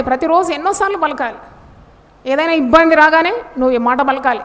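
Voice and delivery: ordinary delivery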